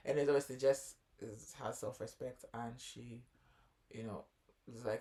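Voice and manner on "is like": normal talking voice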